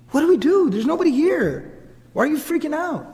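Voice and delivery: high-pitched, baffled questioning; retold helpless bewilderment. Family stands round the screaming man, retold helpless bewilderment. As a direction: voice relatives at a total loss.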